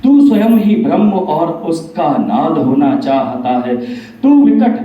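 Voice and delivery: forceful declamation, hitting each line's opening; Bhagwan in recited verse. Acted. Bhagwan rebuking Kaliyug for wanting godhood, emphatic declamatory recitation. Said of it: "यहाँ भगवान कलयुग से बताते हैं".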